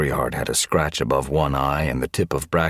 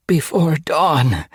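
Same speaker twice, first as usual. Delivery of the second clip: high, slow, whimpered reply; wounded deputy whimpering her answer; 12 semitones higher, 6 dB louder, slower than usual. A narrator's reading. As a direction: whimper it out, hurt and scared. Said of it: "Berryheart whimpered".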